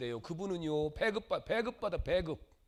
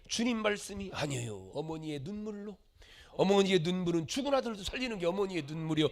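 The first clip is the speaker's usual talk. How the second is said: ordinary talk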